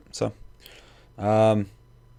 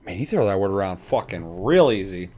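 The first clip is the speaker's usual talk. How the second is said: ordinary delivery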